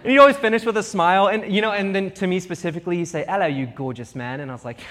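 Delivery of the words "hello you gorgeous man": lower-pitched mock British greeting; imitated British accent. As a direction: put on a Brit's accent, lower. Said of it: "he had this wonderful accent".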